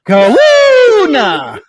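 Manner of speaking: slow, loud stretched call; drawn-out shout. Acted. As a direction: call the name out long. Long drawn-out call of a name.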